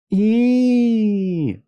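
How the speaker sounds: long, high, loud "ee" squeal; loud sustained high shriek; children's squeal. A narrator's reading. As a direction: squeal like thrilled, spooked children. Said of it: "said the children".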